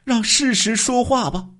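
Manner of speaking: high woman's voice through clenched teeth; the wife answers her husband crying through gritted teeth, high-voiced; tearful, teeth gritted. A narrator's reading. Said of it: "刘秀兰哭着咬牙说".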